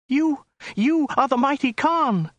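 high, stammering, frightened woman; terrified cry. A narrator's reading. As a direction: squeal it out in terror. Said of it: "cried the woman".

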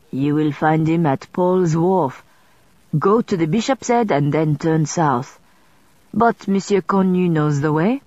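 put-on light French accent on Mister Sweden; a scrivener greeting her in French-accented English; French accent, lightly done. Scrivener gives directions.

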